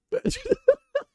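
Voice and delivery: high, loud cackling laugh. Real, not acted. Cracking up at his coach imitation, high loud laugh. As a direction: burst out laughing.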